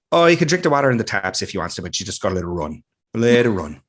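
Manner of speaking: quick, Irish-tinged Newfoundland lilt; Newfoundland accent. Retold or imitated. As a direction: imitate a thick Newfoundland accent, rattled off. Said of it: "I'll butcher it".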